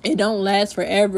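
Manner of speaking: ordinary delivery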